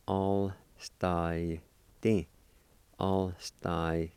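"All sty" drawn out with gaps; slow syllable-by-syllable. Shown as a demonstration. Teacher showing how it sounds, slow syllable-by-syllable. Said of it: "sound something like this".

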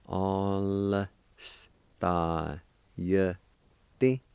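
"All-star. Y, T." in long separate sounds; slow syllable-by-syllable. Shown as a demonstration. Teacher showing how it's pronounced, slow syllable-by-syllable. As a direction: hold "All-star", then set Y and T apart. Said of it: "like this".

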